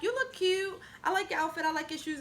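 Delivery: high-pitched gushing friends; gushing compliments. Retold or imitated. Friends gushing compliments in a retold scene.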